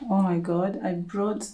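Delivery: usual speaking voice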